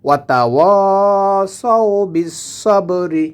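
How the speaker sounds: "ওয়াতাওয়াসাউ" chanted with long third vowel; correct stretched recitation. Shown as a demonstration. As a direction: hold the wa before sau in "ওয়াতাওয়াসাউ". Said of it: "তাহলে হবে".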